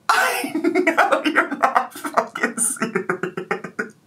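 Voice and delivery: high, slow words dissolving into laughter; helpless laughter. Real, not acted. Vlogger disbelieving what the clip showed, breaking into laughter. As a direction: lose the sentence to wheezing laughs. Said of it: "I can't stop laughing".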